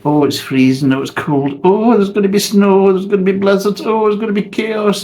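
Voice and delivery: high, whiny mock panic, building up; sneering impression of panicky neighbours; mocking the doom-mongers. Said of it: "everybody says it's cold".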